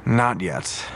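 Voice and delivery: ordinary delivery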